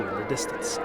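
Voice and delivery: ordinary delivery, nothing marked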